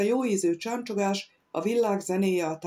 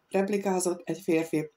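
ordinary delivery

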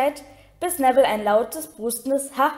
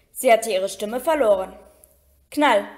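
usual speaking voice

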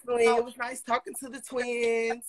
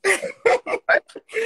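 high squealing laugh; 13 semitones higher, 10 dB louder than usual. Real, not acted. Laughing at compliments.

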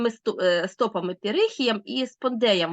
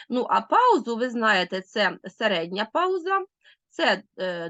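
usual speaking voice

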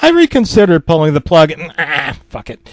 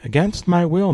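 usual speaking voice

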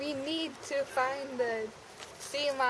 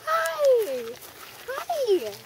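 high cutesy hi; 11 semitones higher, 7 dB louder than usual. Acted. Greeting the sea monster.

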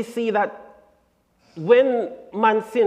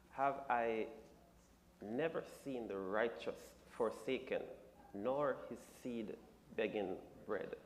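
scripture reading; 7 semitones lower, 18 dB quieter than usual. Real, not acted. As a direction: read it like a hushed recitation. Reads the scripture verse.

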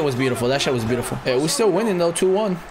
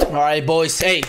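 normal talking voice

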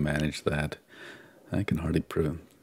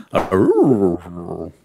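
louder cartoon dog howl; Scooby-Doo howl; 9 dB louder than usual. Shown as a demonstration. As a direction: do a goofy Scooby-Doo "Arroo". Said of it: "More like Scooby-Doo than Wolfman Jack".

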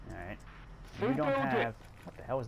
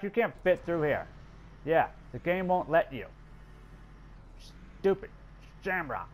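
ordinary talk, nothing marked

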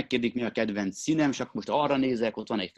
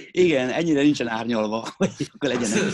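loud, amused reply breaking into laughter; laughing; 3 semitones higher, 7 dB louder than usual. Real, not acted. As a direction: laugh your way through the words. Amused at imagined bodybuilder little girl. (nevetve, hangosan)

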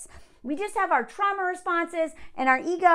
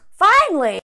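loud, high relieved exclaim; exasperated finally; 6 semitones higher, 10 dB louder than usual. Real, not acted. Reacting to the letter writer's news, loud exasperated exclaim. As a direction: throw up your hands, finally. Reading he went to therapy.

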